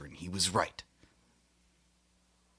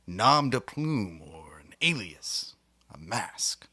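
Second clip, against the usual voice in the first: slow, secretive whisper; much slower than usual. Acted. Host confiding his fake name in a slow whisper. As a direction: let them in on a secret, whispered.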